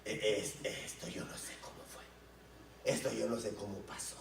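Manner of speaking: susurrado y más suave, titubeando; susurro asombrado. Retold or imitated. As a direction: susurra sin creerlo. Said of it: "yo dije". (susurrando asombrado)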